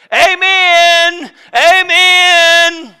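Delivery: loud, high, long called-out amens; shouted amen. Retold or imitated. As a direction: boom them like a congregation cue. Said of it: "they're gonna stand up and say".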